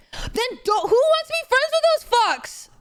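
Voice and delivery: high-pitched, exasperated blurt; indignant outburst. Real, not acted. Indignant outburst, apologises right after.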